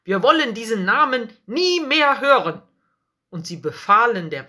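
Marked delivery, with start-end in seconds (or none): 0.0-2.6 raised, higher voice of outraged priests; indignant. A narrator's reading. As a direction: protest with outrage, voice up. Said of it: "riefen sie empört". (empört und mit erhobener Stimme)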